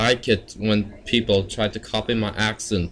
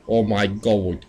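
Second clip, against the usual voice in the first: normal talking voice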